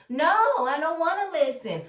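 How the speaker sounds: high, whiny mock Portia; her tone. Mocking Portia refusing the producers, high and whiny. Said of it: "In her tone".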